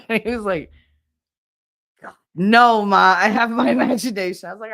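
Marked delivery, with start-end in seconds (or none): 2.4-4.5 loud mock protest with a laugh; loud, laughing retold indignation; embarrassed son protesting. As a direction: imitate a teen objecting loudly to mom. Voicing son's embarrassed protest.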